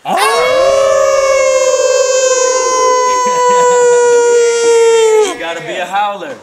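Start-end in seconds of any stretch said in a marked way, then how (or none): 0.1-5.3 loud high howl. Pretend. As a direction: throw your head back and howl. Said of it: "I'm gonna do a little how in three, two, one".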